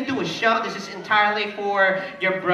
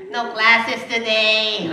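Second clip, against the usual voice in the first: slow, louder drawn-out reply; slow emphatic puppet; 2 semitones higher, 5 dB louder, much slower than usual. Pretend. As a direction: stretch each word out. Repeated slower louder.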